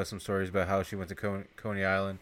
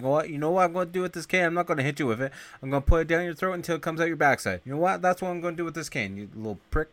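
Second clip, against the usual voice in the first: fast menacing threat; 6 semitones higher, faster than usual. Retold or imitated. Duran voiced, threatening with cane.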